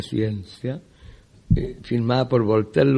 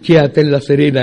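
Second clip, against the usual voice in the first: laughing, louder, mocking her own poem; reciting through laughter; 2 semitones higher, 9 dB louder than usual. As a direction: poema recitado entre risas, más fuerte. Recitando su poema ridículo entre risas. (declamando riéndose de sí misma)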